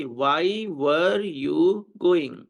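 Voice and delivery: very slow, word by word; slow model sentence. Shown as a demonstration. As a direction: space out each word for learners. Teacher models translation slowly. (बहुत धीरे, एक-एक शब्द)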